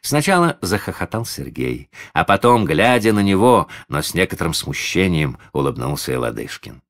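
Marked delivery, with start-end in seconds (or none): none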